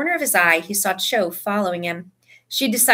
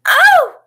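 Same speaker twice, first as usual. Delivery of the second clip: loud, very high shriek; sudden shrieked "Oh!"; short scream; 11 dB louder than usual. A narrator's reading. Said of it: "screamed Cho".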